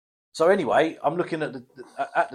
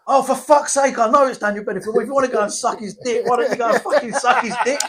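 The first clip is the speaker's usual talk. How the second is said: higher, louder, fed-up outburst; lightly voiced irritated friend; mock exasperated mate; 9 semitones higher, 4 dB louder than usual. Annoyed mate snapping at friend in the shop, higher.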